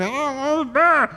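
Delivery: put-on high baby babble; babbling toddler. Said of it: "pointing and".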